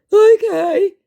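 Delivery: very high, loud, put-on voice; dopey mock okay. Scammer missing the sarcasm, dopey okay. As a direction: squeak it like a clueless dolt. Idiot response, acted.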